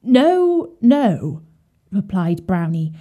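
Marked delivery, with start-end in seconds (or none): 0.0-1.4 higher, louder fearful no; a frightened little pig cries out; frightened refusal. A narrator's reading. Brownie refuses the fox.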